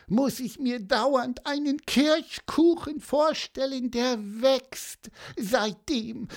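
very high squeaky bird voice, pleading for cake; greedy bird craving. Acted. Pieper begs Paulus for cherry cake, high pleading chirp. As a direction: beg like a tiny bird dying for cherry cake.

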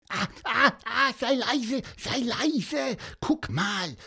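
hohe, gedrängte Pseudo-Flüsterstimme; aufgeregter Vogel. Acted. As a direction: aufgeregt und hoch zischen, nicht wirklich flüstern. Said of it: "flüsterte Pieper". (Pieper warnt Antonius, hoch und gespielt leise)

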